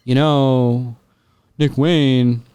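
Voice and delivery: lightly voiced wrestler savoring each word. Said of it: "talking with this sl-, like, deliberate".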